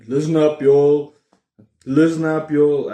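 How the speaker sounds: loud, hammed-up American call of "Listen up, y'all" repeated; American thing. Vlogger mocking American YouTubers with a loud hammed-up American accent. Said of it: "every fifth word I'm gonna try to".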